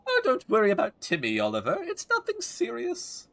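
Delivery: high quavering granny, fretting over her cat; doting old lady. Acted. Voices Layla reassuring.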